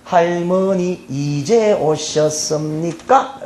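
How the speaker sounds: very slow, drawn-out polite Seoul question; slow Seoul speech. Acted. Seoul people greeting grandma coming home, slow Seoul speech. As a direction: stretch each syllable in careful Seoul politeness. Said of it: "서울에 있는 사람들은".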